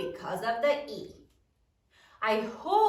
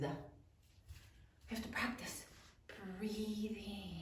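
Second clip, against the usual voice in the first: soft whispered aside; whispered reminder while modelling; 4 semitones lower, 16 dB quieter, slower than usual. Real, not acted. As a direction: murmur it quietly to yourself.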